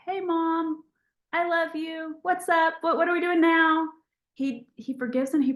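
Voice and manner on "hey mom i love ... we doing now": dog's voice. Pretend. The dog Rusty coming back to his mom, sweet and eager. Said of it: "he's just like".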